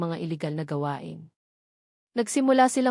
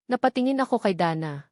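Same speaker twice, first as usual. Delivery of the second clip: plain reading voice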